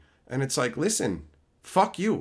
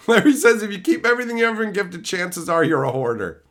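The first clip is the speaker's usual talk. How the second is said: laughing; 5 semitones higher, 4 dB louder than usual. Real, not acted. Reading chat while laughing.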